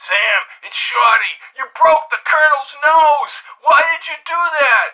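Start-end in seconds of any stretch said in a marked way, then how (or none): 1.6-4.9 high, dismayed scolding; exasperated high-voiced complaint; upset reproach. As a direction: demand an explanation, pitched up. Shorty reproaches Sam about the nose.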